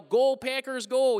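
put-on Wisconsin-accented cheer. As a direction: cheer "Go Packers" like a local Wisconsin fan. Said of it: "I'd yell".

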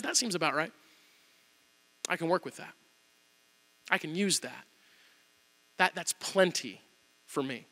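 God reassuring Gideon softly; calm assurance. Retold or imitated. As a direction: reassure him gently. God calmly answers Gideon.

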